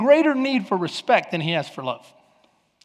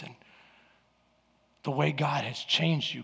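much softer, low and tender; tender praise; 4 semitones lower, 9 dB quieter than usual. Retold or imitated. Wife softly affirming her husband. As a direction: praise him gently and sincerely. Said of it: "when my wife starts saying".

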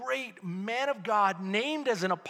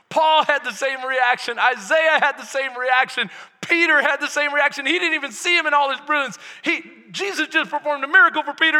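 loud, rising sermon voice; preaching at full force; 4 semitones higher, 6 dB louder than usual. Acted. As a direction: preach it out with fire.

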